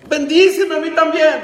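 high, impassioned cry for a blessing; imitating tearful pleading. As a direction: beg desperately, acted from the pulpit. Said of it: "con lágrimas".

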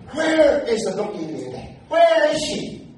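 loud, rising call with a laugh; loud and jolly. Retold or imitated. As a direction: call out for the bride gleefully.

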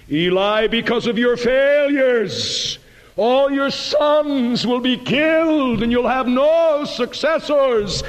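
high, slow, ringing denunciation; prophet pronouncing judgment. Retold or imitated. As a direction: declare doom from the pulpit.

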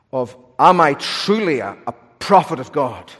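usual speaking voice

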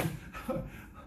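soft unvoiced chuckle; a short airless laugh; breathy laugh. Real, not acted. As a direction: laugh quietly at yourself. Laugh after begging.

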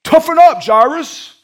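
loud, high, indignant shouting, acted out; hammed-up self-important scolding; self-righteous. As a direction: play at pulling rank, booming. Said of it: "I would have been self-righteous".

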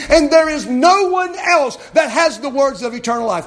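loud, high preaching cry; fiery preaching. Acted. Pastor urging the congregation, preaching loud and high. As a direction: preach it out with full force.

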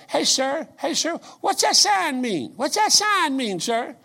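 high, slow, drawn-out street call; lightly voiced curious stranger hailing someone. Two young men calling to the preacher about his sign, high and drawn out.

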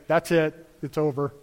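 a hint of a weary parent winding down; tired parent. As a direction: gently close the game like a tired parent. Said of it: "the parent finally has to say".

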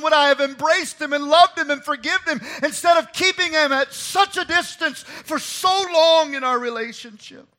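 high, loud, pleading outcry; anguished lament. Acted. Preacher voicing David's regrets over his son, loud high lament. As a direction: cry out each regret in anguish. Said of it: "the grief that overwhelms him".

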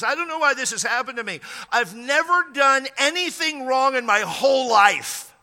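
high, loud retold plea of innocence; indignant protest. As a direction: imitate him protesting, stretching out the final words. Retelling man's protest of innocence.

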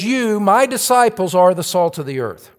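"You" high and loud, then settling down; authoritative stress on You. Shown as a demonstration. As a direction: proclaim it with authority, leaning on "You". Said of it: "Jesus tells us authoritatively".

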